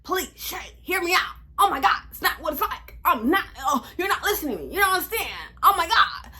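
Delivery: high whiny mock pleading; pleading boyfriend. Boyfriend blocking her car, hammed-up whiny pleading. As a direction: imitate him begging her not to go. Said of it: "do the [name]".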